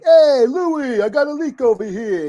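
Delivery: put-on loud, high New York Italian accent; mock mobster with a New York Italian accent; De Niro. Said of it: "talk like De Niro in Goodfellas".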